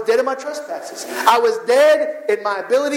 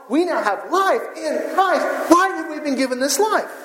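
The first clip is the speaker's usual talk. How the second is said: rising preaching; 4 semitones higher than usual. Acted. Pastor preaching to the congregation, rising and fervent. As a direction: preach it out, climbing in pitch.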